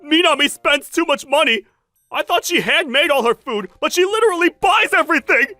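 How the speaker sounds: worked-up, whiny outburst; exasperated complaint. Acted. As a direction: rant in disbelief about a lover's spending. Ex-husband phones to complain.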